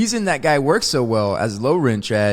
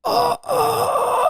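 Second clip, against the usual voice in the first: imitated anguished groan. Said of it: "you just hear".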